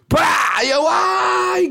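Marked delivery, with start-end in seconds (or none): 0.1-0.6 high, explosive wax-strip rip; imitated rip. Waxer yanks the strip off, imitated rip. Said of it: "gritando no negócio". 0.9-1.7 long, high drawn-out yelp of pain; imitated pain scream. Client screaming as wax rips, imitated scream. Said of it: "gritando no negócio".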